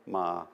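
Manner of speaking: "ma" on a neutral tone. Shown as a demonstration. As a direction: say "ma" level, with no rise or fall. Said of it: "with the six different marks on it".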